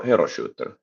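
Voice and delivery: usual speaking voice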